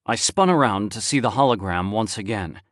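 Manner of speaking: ordinary delivery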